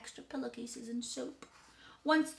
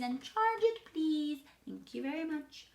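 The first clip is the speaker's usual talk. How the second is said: high singsong sign-off; child ordering grandly; 7 semitones higher than usual. A narrator's reading. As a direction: lilt the polite order up high. Eloise orders room service.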